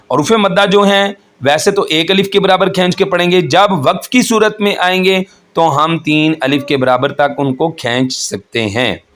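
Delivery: ordinary talk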